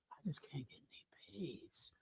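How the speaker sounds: whispered, whiny mock complaint. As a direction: whisper it like a sulking husband. Mocking a man's complaint.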